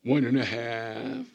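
mock the parent who keeps stalling; stalling parent count. Mocking parents who stretch the count, slow, rising and loud. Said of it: "like parents go".

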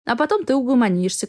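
usual speaking voice

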